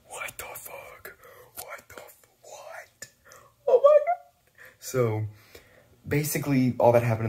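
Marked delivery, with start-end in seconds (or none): 0.1-3.1 stunned, breathless whisper of disbelief; hushed, slow, awed whisper; shocked whisper. Real, not acted. Vlogger reacting alone to the twist, in a shocked whisper. 3.7-4.3 sudden high, loud cry of shock; shocked outburst. Real, not acted. Vlogger erupting at the big twist, in a loud, high shocked cry.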